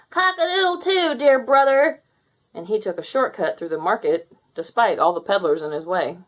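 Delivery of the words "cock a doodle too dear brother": high, loud crowing mockery; cackling taunt. Fowler cackles at Henry while taking a shortcut, high and loud. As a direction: crow over your brother as you cheat. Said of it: "but Fowler cackled".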